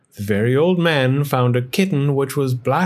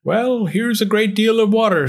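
put-on old man's voice; 10 semitones higher than usual. A narrator's reading. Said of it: "said the very old man".

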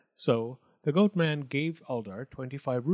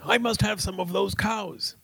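higher, louder, greedy; greedy goatman; 7 semitones higher, 3 dB louder than usual. A narrator's reading. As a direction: say it with greedy eagerness.

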